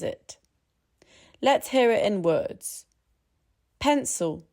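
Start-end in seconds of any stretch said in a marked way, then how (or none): 3.8-4.4 PENCIL said PEN-sul, the i reduced to schwa; schwa reduction. Shown as a demonstration. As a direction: stress the first syllable of Pencil and swallow the i. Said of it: "The I disappears into a schwa".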